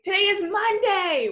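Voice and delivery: "Today is Monday" lifted high and bright with excitement; excited high exclamation. Shown as a demonstration. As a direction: say it like it's big news. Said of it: "with an exclamation point".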